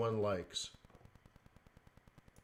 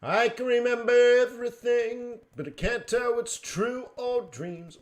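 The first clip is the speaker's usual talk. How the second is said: dreamy movie line; 11 semitones higher, 8 dB louder than usual. Pretend. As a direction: recite it like a dramatic movie line. Sudden quote in odd voice.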